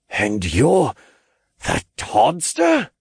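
slow, climbing to a high astonished question; gasping realization. A narrator's reading. Freed captive recognizes Todd, gasping in realization. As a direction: let recognition dawn, voice leaping up. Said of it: "he gasped as realization set in".

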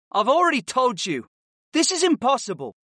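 high-pitched protesting pupil; exasperated boy student. Acted. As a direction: raise the pitch well up, insisting.

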